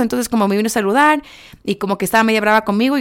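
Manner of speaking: ordinary delivery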